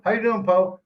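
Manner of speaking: high, eager polite greeting; imitating a respectful greeting. Locals greet the old expat politely, high and eager. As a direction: mimic locals greeting an elder. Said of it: "That's respect".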